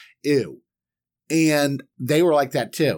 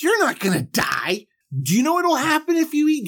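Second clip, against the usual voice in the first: suddenly much higher and fast, eager tempting; excited serpent; 10 semitones higher, faster than usual. Retold or imitated. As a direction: hype her up like a pushy salesman. Serpent tempts, pitch leaps.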